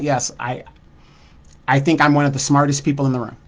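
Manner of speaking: ordinary talk, nothing marked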